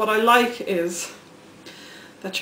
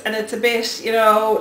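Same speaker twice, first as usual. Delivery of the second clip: normal talking voice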